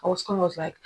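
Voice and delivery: ordinary talk, nothing marked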